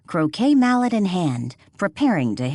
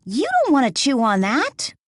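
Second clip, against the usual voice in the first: higher, louder scolding of the dog; 9 semitones higher, 3 dB louder than usual. A narrator's reading. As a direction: tell the dog off. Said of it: "In a scolding voice".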